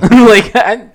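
laughing. Real, not acted. Laughing rant about a face.

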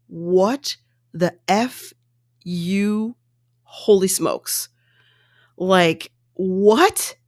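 slow, drawn-out stunned disbelief. Retold or imitated. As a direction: drag it out, dumbfounded. Viewer's disbelief.

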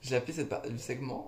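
normal talking voice

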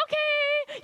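a taken-aback high squeak; squeaky startled okay. Retold or imitated. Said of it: "I was like".

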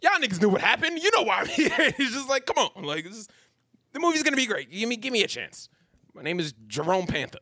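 high, laughing mock hero speech; hammed-up superhero pitch; character voice. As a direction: play the hero pleading to the camera. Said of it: "It's like".

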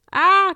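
short, high, loud storybook scream; sharp high yelp of alarm; alarmed cry. A narrator's reading. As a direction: cry out in acted fright. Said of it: "cried Mallow".

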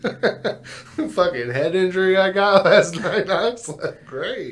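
very slow, high, laughing daze; very slow, high daze mocking the friend's fall; mock dazed voice. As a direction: act dazed and concussed, drawn out.

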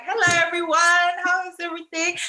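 high, loud, sing-out hello; too-loud greeting. Retold or imitated. Student walking into class of men, too-loud greeting. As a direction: call it out to the whole room. Acting her entrance, told she's too loud.

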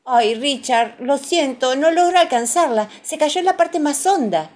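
high, loud, fretful mum voice; high-pitched motherly regret; apologetic mother. A narrator's reading. Mother apologising to crying child.

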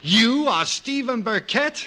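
gasp, then a slow, high, loud disbelief; floored by who's at the door; husband stunned to meet his rival, slow and loud; shocked. Acted.

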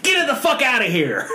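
high, hammed-up yell; mock outraged dismissal. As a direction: throw them out with comic outrage. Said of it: "and they're like".

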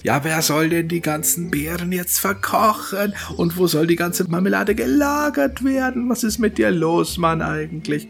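high-pitched nagging wife, scolding her husband; scolding dwarf wife. Acted. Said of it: "jetzt schimpft sie ihn schon wieder aus". (hohe, keifende Zwergenfrau)

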